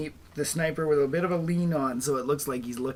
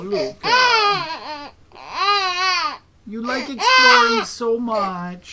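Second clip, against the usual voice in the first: comforting; 10 semitones higher, 8 dB louder, much slower than usual. Real, not acted. Baby hurt his fingers, dad consoles.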